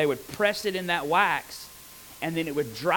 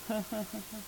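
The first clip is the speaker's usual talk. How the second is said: soft, fake little laugh; mock light laugh; 4 semitones higher, 12 dB quieter, much faster than usual. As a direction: brush it off with a tiny laugh. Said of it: "he's not just going".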